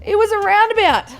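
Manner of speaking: high, loud disbelief; mock dismay. Quiz player learning the guess was wrong, loud dismay.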